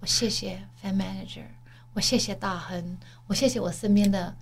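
whisper-close, earnest gratitude; sincere thanks. Shown as a demonstration. Demonstrating the manifesting step to her cohost: soft sincere thanks. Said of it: "你要很认真地讲出来".

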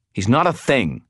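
heated correction; snarled. A narrator's reading. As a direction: snarl it in someone's defence. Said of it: "I snarled".

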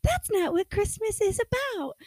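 very high, slow put-on jolly voice. Host mocking Christmas cheer to co-host, jolly and high. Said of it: "that's my fucking jolly voice".

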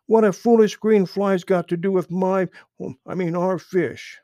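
high, loud impatient demand; angry. A narrator's reading. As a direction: demand an answer crossly. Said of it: "snapped Little Joe".

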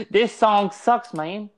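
slow, high mock British put-on; mock British accent. Mocking England on a podcast, hammed-up British accent. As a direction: drawl it like a jeering English lad. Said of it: "I'm not gonna do an England, English accent".

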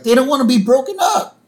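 put-on high, loud little-girl wail; Lisa. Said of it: "And Lisa's like".

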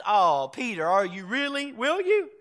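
lightly acted skeptical questioning; Jesus doubting Peter. Lord answering his disciple's vow, doubtful, pitch climbing. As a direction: question the vow, jumping high at the end.

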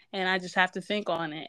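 usual speaking voice

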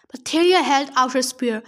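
ordinary delivery

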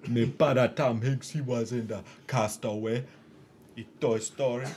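hammed-up Jamaican accent impression. Mimicking Tom Hanks' son boasting of his father, Jamaican accent. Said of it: "Jamaican accent".

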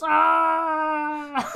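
sudden high shouted Ah; a loud acted cry of effort; reenacted scream. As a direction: let out a sharp pained yell. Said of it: "I hope everyone likes my reenactment".

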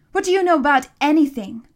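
fast, high, loud outburst; spat out. A narrator's reading. Furious boy challenging the monster, spitting it out. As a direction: fling it out angrily, loud and quick. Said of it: "Connor spat".

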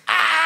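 sudden loud, high scream; imitated scream. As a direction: let out a mock horror shriek. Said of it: "and then it screams".

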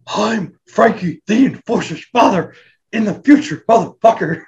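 mock Vader promo. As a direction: yell it like a wrestler cutting a promo. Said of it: "And Vader's just like".

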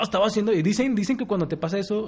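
usual speaking voice

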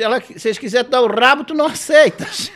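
loud, high imitation of a yelling grandmother; acted granny shout breaking into laughter; shouted taunt. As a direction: holler it down the street.

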